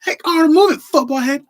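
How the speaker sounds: loud, high bratty bark; bully a kid at a shout; hammed-up cartoon bully girl; Helga. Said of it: "Helga ... the way she'd act when Arnold was around".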